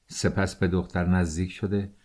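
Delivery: ordinary delivery, nothing marked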